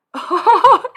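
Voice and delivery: high loud cackling laugh; loud burst of laughter. Real, not acted. Streamer cracking up at a chatter's joke, loud high laugh. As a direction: explode into laughter.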